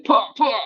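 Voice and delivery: high, loud mock martial-arts shout; hammed-up kung fu strike cry; karate yell. Elbowing enemies again, bonus points.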